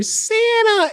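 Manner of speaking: put-on very high delighted child cry; kids calling Santa. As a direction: squeal his name like an excited child.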